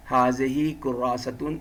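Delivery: حاضی کراستن slow, every vowel ending voiced; careful Arabic reading. Shown as a demonstration. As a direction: read it slowly with full case endings. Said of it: "ہم اس کو اعراب کے ساتھ پڑھیں گے". (عربی اعراب کے ساتھ آہستہ تلفظ)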